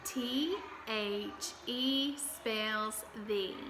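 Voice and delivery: slow, letter by letter; slow spelling. Shown as a demonstration. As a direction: spell it out slowly for kids. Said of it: "teacher models spelling aloud".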